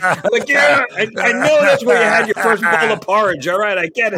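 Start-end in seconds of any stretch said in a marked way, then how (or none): 0.5-1.1 fed up. Retold or imitated. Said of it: "it started getting old". 1.2-3.5 higher, louder, mock weary brush-off of "porridge"; fed-up retort. Answering crewmate's repeated porridge story, mock exasperated brush-off. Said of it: "it started getting old". 3.5-4.2 fed up. Retold or imitated. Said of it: "it started getting old".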